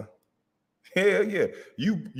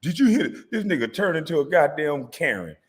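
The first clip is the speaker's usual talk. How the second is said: higher, put-on complaining voice of the rival; mock whiny victim; 6 semitones higher than usual. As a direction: play the wronged one, hammed-up and high. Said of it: "if somebody say anything to you".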